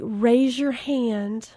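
slow, soft meditation-teacher voice; zen instructor. Retold or imitated. Instructor addressing her only student, slow soft zen voice. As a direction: float it out like a calm yoga guide. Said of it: "she's kinda got her zen on".

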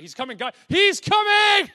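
frantic rising shout, dragged out at the top; high, loud panic, slowing on the last words; panicked cry. Acted. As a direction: cry it out in alarm as danger nears. Panicked alert at the enemy.

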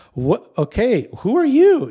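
loud, high-pitched astonished demand; who is this kid, voice leaping up; mock incredulity. Mock reaction to son's behavior.

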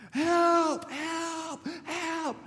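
high-pitched, drawn-out acted shout; mock cries for help. Joe trapped in the freezer yells for help, mock shouting. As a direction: act out yelling through a shut door.

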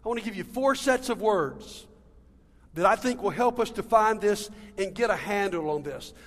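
normal talking voice

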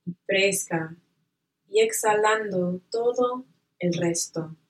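calm, unhurried visualization voice; guided meditation. Acted.

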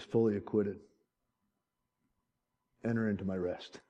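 low, soft pronouncement; hushed verdict. Acted. When that gavel drops, hushed verdict. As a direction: say it low and gently, very quietly. Judge acquits, preacher moved.

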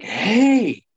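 high mock shout of a stranger in the woods; imitating a man yelling out in the woods; retold yell. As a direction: call it out like a man far off. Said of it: "a grown man just yell".

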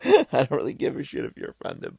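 defiant laughing aside to viewers who might be offended. Real, not acted. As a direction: toss it off with a laugh. Laughs after joking about the accent.

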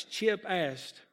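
ordinary delivery, nothing marked

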